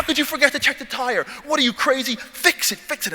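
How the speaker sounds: high, exasperated retold yell; mock yelling. Trainee to his people over the flat tire, mock yelling. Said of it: "He's yelling at his people".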